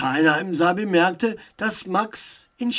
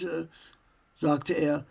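ordinary delivery

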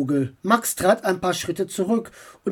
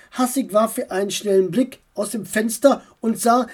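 plain reading voice

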